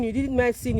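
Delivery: ordinary delivery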